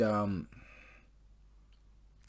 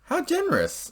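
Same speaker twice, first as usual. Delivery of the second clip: sarcastic amazement; 4 dB louder than usual. Retold or imitated. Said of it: "I was like".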